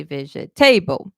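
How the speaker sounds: vegetable as veh-jeh-TAY-bul; stress on the end. Shown as a demonstration. Teacher shows other languages' pattern, end stressed. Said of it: "But in some languages, you're gonna say".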